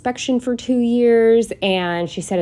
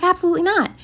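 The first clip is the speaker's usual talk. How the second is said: mock outraged refusal; 11 semitones higher, much faster than usual. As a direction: refuse flatly, pitched up high. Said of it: "I was like".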